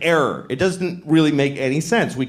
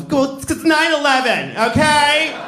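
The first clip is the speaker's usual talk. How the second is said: high, indignant whine; defensive America; 9 semitones higher, slower than usual. Acted. America as girlfriend excusing bombing, high indignant whine. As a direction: squeal the excuse like a sulky girlfriend.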